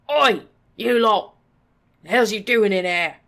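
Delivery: loud, rough goblin yell; angry yell. A narrator's reading. Goblin butcher yelling at trespassers. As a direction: bark it at the intruders. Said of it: "the butcher yelled".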